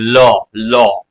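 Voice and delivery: ल said clearly with the tongue curled to the side; ल sound demo. Shown as a demonstration. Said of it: "जिह्वा हमारी पार्श्व की तरफ ऐसे मुड़ जाती है".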